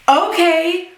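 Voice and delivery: high-pitched, thrilled disbelief; pleasantly surprised. Retold or imitated. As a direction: shoot the pitch up in delighted surprise. Reacting to surprise money offer.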